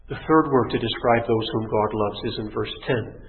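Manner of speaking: ordinary delivery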